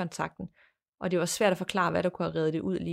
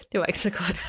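laughing; 2 semitones higher than usual. Real, not acted. Laughs at own bad imitation.

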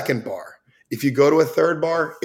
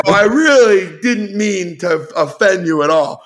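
apologetic plea; 7 semitones higher, 5 dB louder than usual. Retold or imitated. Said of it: "I go".

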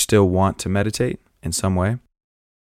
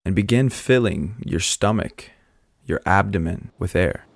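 calm, unhurried meditation guide with long pauses; guided meditation; 6 dB quieter than usual. Acted. Meditation host guiding listeners to breathe down into the earth, calm and measured. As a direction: ease them through each breath, leaving space between phrases.

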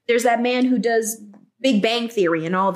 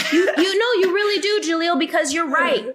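excited praise; 7 semitones higher than usual. Real, not acted. Praising Jaleel emphatically.

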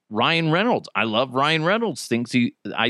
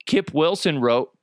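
ordinary talk

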